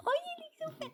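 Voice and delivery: put-on very high cooing baby voice; baby talk. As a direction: squeal it to the pet. Repeat, even higher baby talk.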